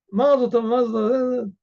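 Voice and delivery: loud, high festive cheer. Acted. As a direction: toast the couple like a drunk guest. Drunk guests cheer the wedding.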